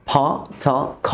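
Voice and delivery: ফ, থ, খ each with a puff of h; breathy release on ফ, থ, খ; aspirated sounds. Shown as a demonstration. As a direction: say ফ, থ, খ separately, heavily aspirated. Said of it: "aspiration হিসেবে উচ্চারণ".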